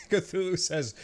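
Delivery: ordinary talk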